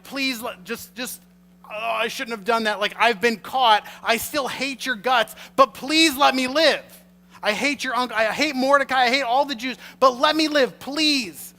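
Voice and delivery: high, loud frantic begging, spitting hate then pleading; urgent pleading. Retold or imitated. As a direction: grovel while still full of hate. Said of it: "the urgency with which you would expect".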